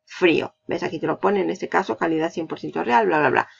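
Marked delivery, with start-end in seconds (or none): none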